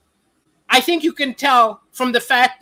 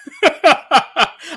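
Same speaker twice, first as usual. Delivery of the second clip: real laugh; 5 semitones higher, 4 dB louder than usual. Real, not acted. Reacting to worrying viewer comment.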